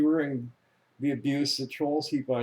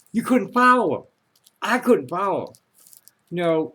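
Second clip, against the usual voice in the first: ordinary talk, nothing marked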